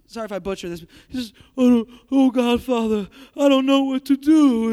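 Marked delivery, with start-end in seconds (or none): 1.6-4.7 woeful singer pleading for help; hammed-up helpless lament, loud opening cry; Johnny Fontane. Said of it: "Oh, woe is Johnny".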